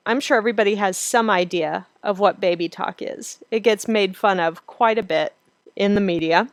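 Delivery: usual speaking voice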